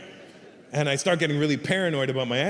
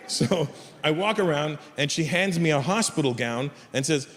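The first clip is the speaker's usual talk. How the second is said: ordinary delivery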